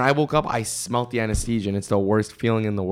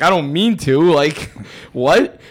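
louder, higher retold protest breaking into a laugh; baffled protest; 5 semitones higher, 6 dB louder, slower than usual. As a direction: protest your innocence, half laughing. Retold protest at being accused.

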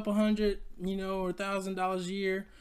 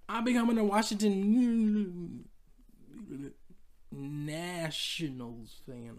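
forced out reluctantly; slower than usual. Retold or imitated. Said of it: "I can't even say it".

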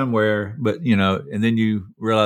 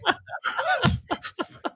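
high squealing laugh; 7 dB quieter than usual. Real, not acted. Laughs after his roar.

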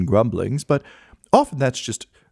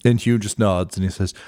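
ordinary delivery

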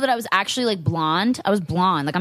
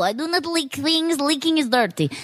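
put-on Russian accent; 7 semitones higher than usual. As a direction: lay on a heavy Russian accent. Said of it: "Oh, no, you talk Russian".

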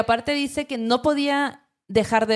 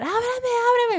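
imitated desperate yell; 14 semitones higher, slower than usual. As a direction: mimic someone calling to be let in. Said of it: "empieza a gritar".

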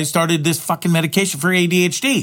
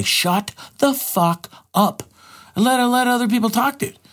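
mock exasperated telling-off; 8 semitones higher than usual. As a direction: drag out the first words, then pitch up on "let". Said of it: "grab Tom Green by the throat and say".

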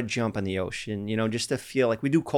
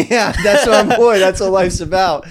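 laughing; 8 semitones higher, 10 dB louder than usual. Real, not acted. Laughing, echoing Steven's motto.

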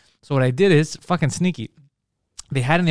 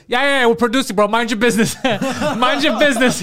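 brash brush-off; 6 semitones higher than usual. Retold or imitated. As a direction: wave him off like a cocky producer. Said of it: "Poseidon's like".

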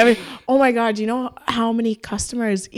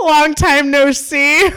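higher, louder, slow laughing fake warmth; hammed-up, laughing hello; mock greeting; 6 semitones higher, 7 dB louder, much slower than usual. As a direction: pretend you remember them.

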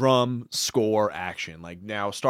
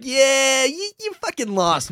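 mock taunt; 11 semitones higher than usual. Retelling Anakin's blunt taunt.

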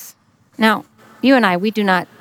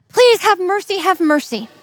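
pleading; 8 semitones higher than usual. Acted. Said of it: "he still said".

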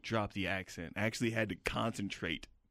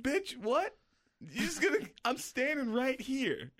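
incredulous outrage; 13 semitones higher than usual. Retold or imitated. His stunned reaction to her remark.

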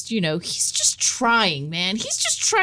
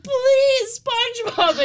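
begging; 14 semitones higher, much slower than usual. Retold or imitated. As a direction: beg him, worn out. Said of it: "She's like".

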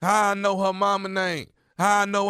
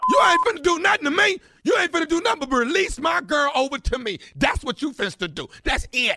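high, fast, heated comeback; defiant shout; 4 semitones higher than usual. Acted. Jailed caller demands his girl back, shouting defiantly. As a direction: shout back, refusing to be threatened. Prankster acts angry jailed boyfriend.

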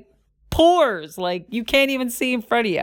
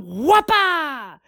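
jump sound effect; 6 semitones higher than usual. Retold or imitated.

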